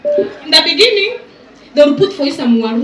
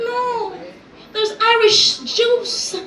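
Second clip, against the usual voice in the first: mock reply; 10 semitones higher, slower than usual. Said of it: "you're like".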